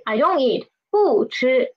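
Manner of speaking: ordinary talk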